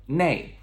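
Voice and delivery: "那" as nei, like nay, falling; descending tone. Shown as a demonstration. Teacher repeating the colloquial form, descending tone. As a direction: say "那" as a firmly dropped nay. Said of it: "with a downward descending tone".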